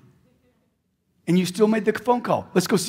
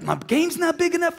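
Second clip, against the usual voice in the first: "My game's not big enough" with "big" jumped much higher; pitched way up; 10 semitones higher than usual. Shown as a demonstration. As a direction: fling the word "big" up high in frustration. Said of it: "repeats the line, then lifts it".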